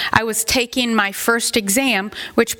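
normal talking voice

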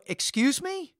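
high, rising questioning pitch; taken aback, voice climbing high; incredulous. Retold or imitated. Incredulous reply to her concerns.

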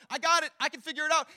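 loud, high know-it-all brush-off; cocky dismissal. Retold or imitated. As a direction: wave the help away, cocky. Us confidently refusing help.

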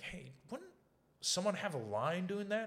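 very high, put-on asking voice; high-pitched retold plea. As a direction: pitch it way up to float a suggestion.

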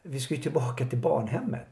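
a child realizing they've been tricked, half-replayed; shocked protest. Child protests at being left behind.

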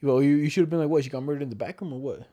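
ordinary delivery

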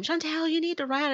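imitated coach, pitched high; high-pitched retold nagging call. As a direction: call a pupil back to running. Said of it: "the coach would be like".

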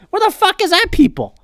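high-pitched indignant question; exasperated demand. Real, not acted. Host asking where the critics went, exasperated demand. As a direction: burst out in exasperation, pitch jumping up. Indignant rant about hypocritical patriots.

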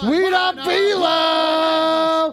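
long, high, stretched wrestling-announcer call; stretched high hype call; drawn-out catchphrase yell. Acted. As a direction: belt it out like a ring announcer. Catchphrase shouted as wrestler persona.

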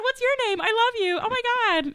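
high, gushing fan voice; fangirling. Retold or imitated. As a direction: gush like a starstruck fan. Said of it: "I start fanning over people".

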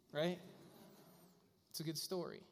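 ordinary talk

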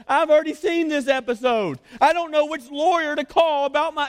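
high, loud mock complaint; mock whining complainer. Preacher mimics a daytime TV watcher complaining, high and loud. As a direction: exaggerate a TV watcher's gripe. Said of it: "and you're like".

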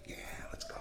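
breathless eager whisper; whispered eager yes. Retold or imitated. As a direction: whisper it like a secret thrill. Eager reply to the pilot.

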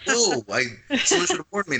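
high-pitched startled reaction; mock shock. Actor recalling a naked person on set, mock shock. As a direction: imitate being taken aback, voice jumping up. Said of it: "it was shocking a bit".

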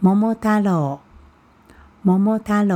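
Momotaro said the Japanese way, pitch even across the word, no heavy first syllable; Japanese pronunciation. Shown as a demonstration. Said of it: "在我们日本的这个发音是叫做". (日语发音，Momotaro音调平稳)

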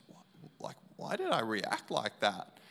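muttered thought. Retold or imitated.

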